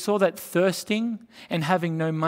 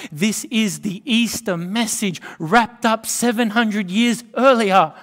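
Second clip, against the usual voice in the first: louder, higher declaration of the Easter message; full-force preaching; 5 semitones higher, 7 dB louder than usual. Acted. Preaching peak.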